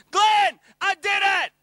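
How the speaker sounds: joyful shout across the shop; excited shout through the window. Retold or imitated. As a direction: yell it out at the top of your voice. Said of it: "he shouted at the top of his voice".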